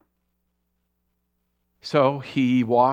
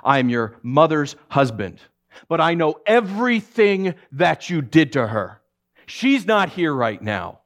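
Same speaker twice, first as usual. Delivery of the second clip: firm, louder warning voice; stern stepfather; 5 semitones higher, 4 dB louder than usual. Retold or imitated. Stepfather barring Patrick from the house, firm and louder. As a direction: lay down the law slowly. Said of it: "Greg looked at him, he said".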